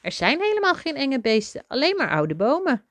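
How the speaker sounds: high, lively mouse voice; merry. A narrator's reading. Mouse telling father the beasts are trees, high merry voice. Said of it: "lachte Toby".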